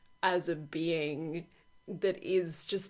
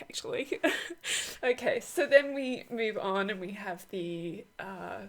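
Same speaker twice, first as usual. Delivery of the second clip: ordinary talk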